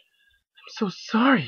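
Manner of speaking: high, slow, timid pony voice; high slow sorrowful plea; tearful apology. Acted. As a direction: apologise as if about to cry. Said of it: "she cried".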